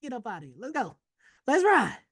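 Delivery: high, joyful thanks; excited let's ride; relieved cheer. Retold or imitated. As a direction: celebrate being freed from the trade. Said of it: "I was like".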